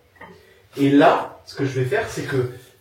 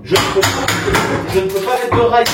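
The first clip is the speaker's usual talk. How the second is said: loud, raised-pitch, exasperated insistence; 5 semitones higher, 8 dB louder, slower than usual. Real, not acted. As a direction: spell it out loudly, fed up. Fed up repeating the explanation.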